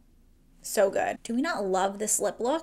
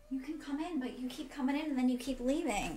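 softer, higher mild scolding of the cat; fond exasperation at the cat; mock exasperation at cat; 4 semitones higher, 10 dB quieter than usual. Real, not acted. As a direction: gently tell off the cat.

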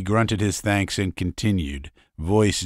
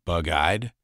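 plain reading voice

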